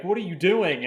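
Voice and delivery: high, loud frightened question; scared demand. Retold or imitated. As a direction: confront him in panic. Christine is scared and confused.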